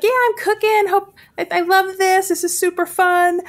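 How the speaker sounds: high-pitched, gushing villager impression; imitating cheery villager. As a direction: play an enthusiastic little cook, pitched well up. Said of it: "and they're like".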